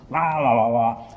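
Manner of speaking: loud muffled wah-wah announcement; garbled loudspeaker. Retold or imitated. Station announcement calls the next train, loud garbled wah-wah. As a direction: imitate the station speaker blaring. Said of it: "I heard the sound go".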